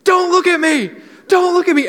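put-on high, loud, panicked child's scream; kid's shrieking yell. Boy yelling at the teens staring at him, loud high shriek. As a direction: shriek it like a kid in a meltdown. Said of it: "he just starts yelling".

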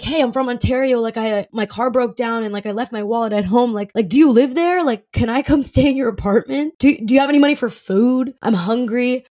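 normal talking voice

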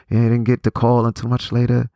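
ordinary talk, nothing marked

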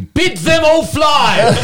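dramatic shout. Acted. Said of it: "But you didn't do it like that".

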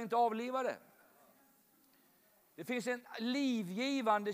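loud, rousing sermon voice, some lines slowed; rally the congregation to be lifted up; preaching at full force. Acted. Preacher urging the church about warmth and giving, full force.

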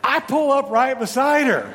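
loud storytelling peak. Real, not acted. Story climax, chasing the car.

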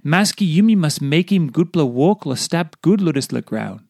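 ordinary talk

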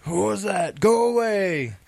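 high-pitched, badly faked character voice; Gronin. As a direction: draw it out high in a hammy impersonation. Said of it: "my really bad impersonation of Gronin".

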